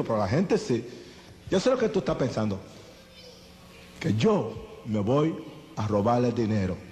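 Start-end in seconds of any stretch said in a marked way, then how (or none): 1.5-6.8 drops lower and slows, menacing; defiant accusation. Retold or imitated. Young gang leader confronting the preacher, low and menacing. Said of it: "addressing Wilkerson defiantly".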